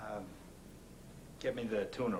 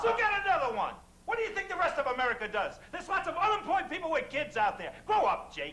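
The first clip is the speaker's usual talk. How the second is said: angry shouting; 4 dB louder than usual. Acted. Heated argument over the kid.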